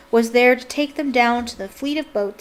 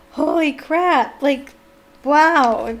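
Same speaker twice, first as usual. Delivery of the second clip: stunned exclamation; 4 semitones higher, 5 dB louder, much slower than usual. Real, not acted. Reader stunned by the ending.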